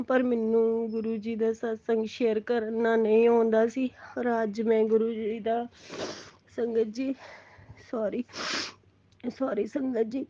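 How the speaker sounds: normal talking voice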